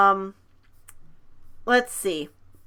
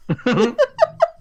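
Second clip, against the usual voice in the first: a high burst of laughter breaking out; 14 semitones higher than usual. Real, not acted. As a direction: crack up at the text, rising high. Laughing at funny text.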